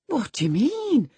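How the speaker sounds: high, swooping question; puzzled servant. A narrator's reading. Zillah questions the housekeeper's accusation, puzzled. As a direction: ask in high-pitched surprise.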